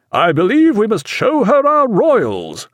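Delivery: high, drawn-out captain's order; slow, high-pitched command that carries. A narrator's reading. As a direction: call the order across the deck. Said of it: "in a louder voice".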